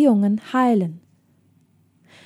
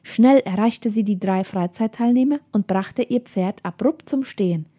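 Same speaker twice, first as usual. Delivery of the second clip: usual speaking voice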